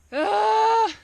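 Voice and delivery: sudden loud cry of frustration; short exasperated shout; frustrated yell. Real, not acted. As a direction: let out a fed-up shout. Frustrated shout on hard loop.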